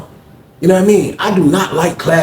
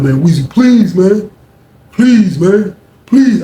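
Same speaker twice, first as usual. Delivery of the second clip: begging that climbs higher and louder with each please; desperate pleading; 5 semitones higher, 5 dB louder, much slower than usual. Retold or imitated. Dylan begging Weezy to step in, rising desperation. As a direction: plead harder and harder.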